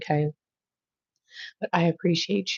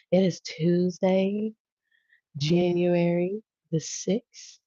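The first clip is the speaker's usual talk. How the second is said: drawn-out date; much slower than usual. Real, not acted. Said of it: "I don't know why I said it like that".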